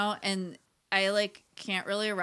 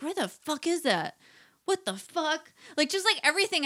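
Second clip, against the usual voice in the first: angry teen imitation; 8 semitones higher than usual. Mom imitating her angry daughter, high mock rant. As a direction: mimic an exasperated teenager swearing. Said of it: "she's like".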